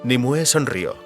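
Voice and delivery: usual speaking voice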